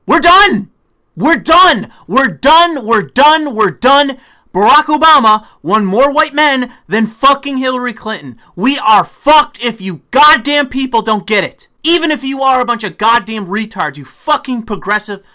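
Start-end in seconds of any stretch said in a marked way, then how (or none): 0.1-4.2 repeated furious shout, much higher and louder; angry shout. Real, not acted. Ranting at progressives, shouting in real fury. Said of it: "I'm getting even more angry". 4.5-13.5 louder, heated swearing rant; angry ranting. Real, not acted. Scolding progressives on camera, angry and loud. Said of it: "I'm getting even more angry".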